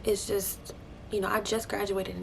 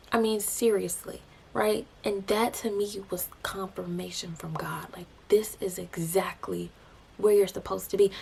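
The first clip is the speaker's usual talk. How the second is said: normal talking voice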